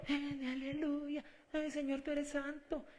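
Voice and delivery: mocking the churchgoer's hollow devotion; mock piety. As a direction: sigh it out like a showy pious hypocrite. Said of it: "esos payasos".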